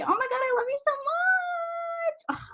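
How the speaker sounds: over-the-top high-pitched adoration; gushing. Real, not acted. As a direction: squeal it with affection, stretching the end. Gushing thanks to a viewer.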